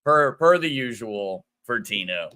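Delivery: usual speaking voice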